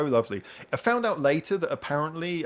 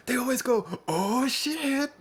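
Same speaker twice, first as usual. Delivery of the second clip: excited translator; 11 semitones higher, slower than usual. Retold or imitated. Translator telling the detainee his favourite bit, high and gleeful. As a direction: gush about the show in a high voice.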